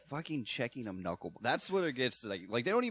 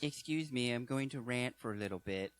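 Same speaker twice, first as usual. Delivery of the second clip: higher, softer mock-polite announcement; put-on voice; 4 semitones higher, 3 dB quieter than usual. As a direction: excuse yourself in a lightly put-on voice. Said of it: "In that voice, too".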